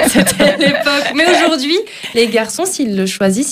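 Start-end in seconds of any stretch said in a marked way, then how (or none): 0.0-1.8 echoed through laughter, pitch higher; laughing while agreeing. Real, not acted. Radio host laughing as he echoes the other host's joke.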